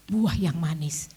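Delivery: slow prayerful drawl. Real, not acted. Prayer drawn out slowly.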